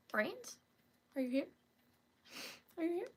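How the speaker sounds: small, soft questioning voice; tentative call. Pretend. Streamer checking viewers are there, timid soft call. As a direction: ask very quietly into the silence.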